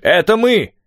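high, loud answering shout; calling back. A narrator's reading. Steve answers the hidden men in the dark, calling back. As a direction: shout back to identify yourselves. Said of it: "откликнулся Стив".

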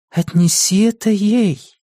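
a hint of a voice from beyond; dead woman's voice. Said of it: "Какая-то женщина произнесла".

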